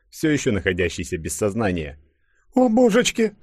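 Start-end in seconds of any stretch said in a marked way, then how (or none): 2.5-3.3 put-on very high, loud girlish cry; high-pitched fretful woman's outcry; alarmed cry. A narrator's reading. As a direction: exclaim in alarm, voice shooting up. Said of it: "вскрикнула Катенька".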